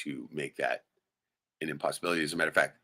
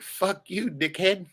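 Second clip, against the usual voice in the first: mock defiant retort; 13 semitones higher, 4 dB louder, much slower than usual. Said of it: "I'd be like".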